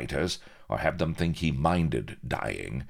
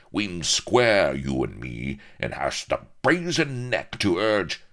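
indignant rustic pirate, louder; blustering pirate; 6 semitones higher, 5 dB louder than usual. Acted. Outraged pirate disputes an old debt, blustering indignation. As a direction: rail at him, rising high and loud.

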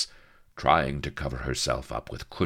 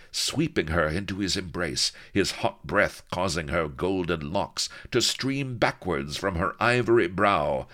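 plain reading voice

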